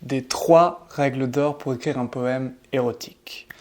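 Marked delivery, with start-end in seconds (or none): 1.7-3.5 drops into a secretive whisper on the title; playful whisper. Acted. Teacher whispers the topic to his viewers, teasingly. Teasing aside on erotic poems.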